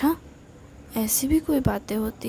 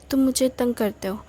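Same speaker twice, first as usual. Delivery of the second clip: plain reading voice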